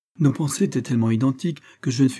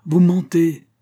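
loud, high accusation; harsh retort; 8 semitones higher, 7 dB louder, slower than usual. A narrator's reading. Judge retorting to the accused, harshly. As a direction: hit back hard and loud. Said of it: "riposta durement le juge".

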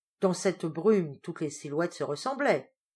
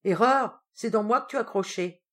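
bass voice; 4 semitones higher, 6 dB louder than usual. Acted. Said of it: "la voix de basse-taille du gros Balanec".

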